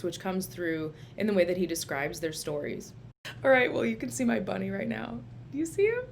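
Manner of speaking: ordinary talk, nothing marked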